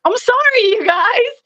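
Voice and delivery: high, whiny little plea; mock plaintive apology. Wrestler apologizing to fans for torn fishnets, mock plaintive.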